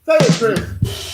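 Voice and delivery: drawn out, slow game show cue; slow announcer prompt. Pretend. Host urging cohost to repeat the line, slowly. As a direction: stretch it like a host's cue. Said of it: "say that again, Chris".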